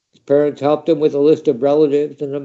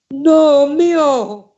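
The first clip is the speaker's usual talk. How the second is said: high, loud shriek of alarm; 12 semitones higher, 4 dB louder than usual. A narrator's reading. Mother shrieking at her son's plan to fight. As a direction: cry it out in sudden dread. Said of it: "Mother shrieked".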